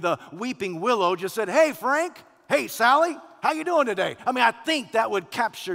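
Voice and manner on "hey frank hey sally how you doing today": high, loud, cheery hailing; chatty talking tree. Acted. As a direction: call out a casual hello to passersby. Weeping willow calls out greeting.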